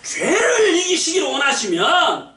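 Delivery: hammed-up revivalist command to the crowd; revival preacher. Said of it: "아, 그러는 거예요". (웃음 섞인 부흥사 흉내, 높게 외치기)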